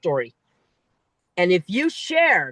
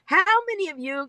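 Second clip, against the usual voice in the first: "How many of you" much higher and faster, mocking; mocking cliché opener; 9 semitones higher, faster than usual. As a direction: parody the tired opener "How many of you" she is sick of hearing. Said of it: "if I hear anybody else start a speech with a question".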